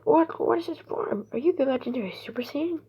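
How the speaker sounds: high astonished questions, fast at the end; high amazed outburst; shocked. Retold or imitated. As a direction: gasp out the questions in disbelief. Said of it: "because, like".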